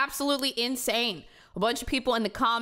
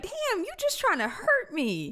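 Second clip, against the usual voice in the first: mock hurt; 10 semitones higher than usual. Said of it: "I'd be like".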